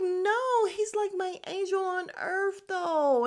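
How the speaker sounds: a high, swooning put-on voice for the castmate's reply; playful high-pitched imitation of a smitten friend; mock gushing girl. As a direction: gush it like she's smitten.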